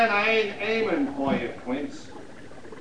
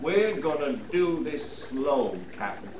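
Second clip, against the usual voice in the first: low menace; 7 semitones lower, 7 dB quieter than usual. Acted. Sniper taunting his pinned target.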